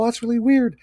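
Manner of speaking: very high, odd squeaky voice; changed tone. Shown as a demonstration. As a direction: pitch it way up to sound weird. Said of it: "All I'm doing is changing my tone".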